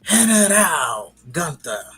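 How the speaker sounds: loud, high, drawn-out announcer call; ring announcer. Pretend. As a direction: boom out the name like a ring announcer. Hyping a wrestler's ring name.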